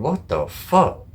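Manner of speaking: drawn-out, slow what-the; slow disbelief. Retold or imitated. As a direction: let the disbelief stretch out slowly. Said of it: "I'm going".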